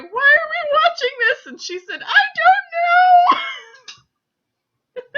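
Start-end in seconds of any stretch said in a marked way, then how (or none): 0.0-1.5 much higher and louder, a hammed-up weepy wail; mock sobbing wail. Wailing at Steel Magnolias with her friend, hammed-up sob. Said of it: "sobbing, going". 2.0-5.1 very high, loud and very slow, hammed-up crying; mock sobbing cry. Friend answering through tears at the movie, mock sobbing.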